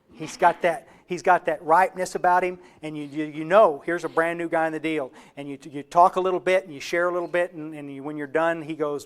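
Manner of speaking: ordinary delivery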